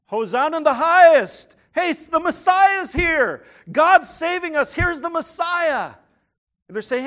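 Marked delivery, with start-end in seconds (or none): 0.1-5.9 loud, high triumphant shouting; crowd shouting hosanna. Retold or imitated. Preacher voicing the crowd cheering Jesus in, loud and exultant.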